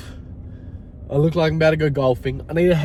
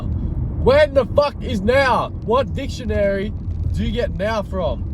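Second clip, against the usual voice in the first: high, slow exasperated outburst; 10 semitones higher, slower than usual. Real, not acted. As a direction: drag it out in disbelief. Exasperated about unpaid invoice.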